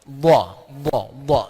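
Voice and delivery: "Dha" as the emphatic Arabic dad, loud; Arabic letter dha. Shown as a demonstration. Teacher demonstrates the Arabic dha sound for the class. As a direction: hit "dha" hard, deep in the throat. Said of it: "Ini makhrajnya di sini".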